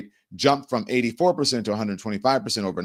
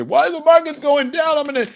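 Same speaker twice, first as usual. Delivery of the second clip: high, loud panicked outburst; mock freak-out; 7 dB louder than usual. Host mimicking the president over a falling market, mock freak-out. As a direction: blurt it out in a high-pitched imitation tantrum. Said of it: "he's gonna freak out and say".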